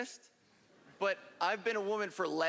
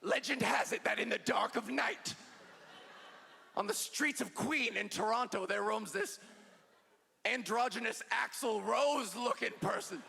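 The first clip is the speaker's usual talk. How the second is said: spooky legend-telling voice for straight dudes; campfire horror storyteller. Retold or imitated. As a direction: tell it like a ghost story by the fire. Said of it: "be like".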